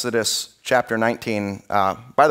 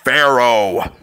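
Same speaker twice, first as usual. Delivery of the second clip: James Earl Jones voice; 5 dB louder than usual. Retold or imitated. Preacher imitating God calling Pharaoh, mock booming voice. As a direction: lightly boom the name like James Earl Jones. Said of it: "his greatest James Earl Jones voice".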